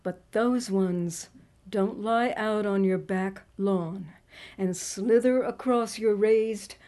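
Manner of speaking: put-on poem read in a Southern accent. Evangelical snake charmer addressing her doubters, Southern accent. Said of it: "I try to read this in a Southern accent".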